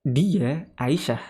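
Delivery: Dia Aisyah said very slowly and deliberately; slow it down so students catch it; slow example. Shown as a demonstration. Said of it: "Kita ganti tapi jadi Aisyah".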